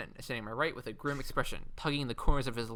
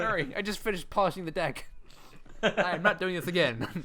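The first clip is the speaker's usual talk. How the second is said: fussy neat freak; 5 semitones higher, 4 dB louder than usual. Pretend. Mock fussy pirate cleanup.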